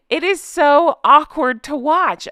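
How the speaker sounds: drawn-out cringe at the awkwardness; cringing. Real, not acted. Cringing at the video.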